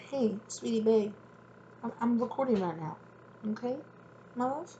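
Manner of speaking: mother softly hushing her daughter while recording; sweet mom voice. Real, not acted. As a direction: coo gently to your little one. Talking to her little daughter.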